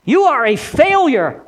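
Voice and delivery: high-pitched, mocking accuser; taunting Satan. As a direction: jeer and condemn, hitting the key words. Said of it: "the field day that Satan had with Peter".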